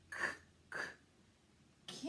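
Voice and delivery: breathy "k-" repeated; sounding out. Shown as a demonstration. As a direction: whisper the "k-" sound. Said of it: "sounding out can".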